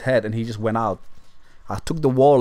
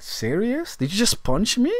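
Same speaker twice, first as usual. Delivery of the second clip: higher, incredulous; the big man, higher and amazed at the punch; mock disbelief; 8 semitones higher than usual. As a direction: ask it stunned.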